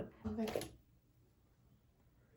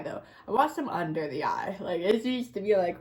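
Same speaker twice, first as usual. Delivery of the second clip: ordinary talk, nothing marked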